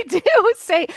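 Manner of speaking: a real giggle on the words; laughing at the water joke. Real, not acted. Laughs while speaking.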